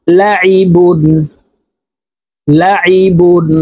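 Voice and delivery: লাইবুন with the আইন pressed from the middle of the throat; slow teaching drill, ain from the throat. Shown as a demonstration. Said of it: "আইনকে হলকের মধ্যখান থেকে চাপ দিয়ে উচ্চারণ".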